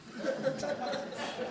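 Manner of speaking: high airy laugh under his breath; breathy laugh. Real, not acted. Preacher laughing at his own sat-nav story, airy. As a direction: let out a soft breathy laugh.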